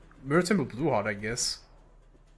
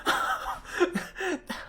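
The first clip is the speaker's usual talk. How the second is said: sudden high-pitched laugh of disbelief; high burst of laughter; 7 dB quieter than usual. Real, not acted. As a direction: cackle at the impossible play. Stunned by a great play.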